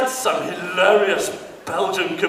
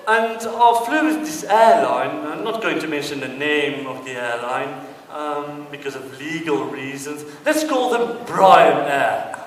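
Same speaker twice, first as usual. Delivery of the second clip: ordinary talk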